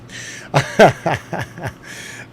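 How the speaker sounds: breathy chuckle at own joke; real, mostly voiceless laugh. Real, not acted. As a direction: chuckle in short bursts.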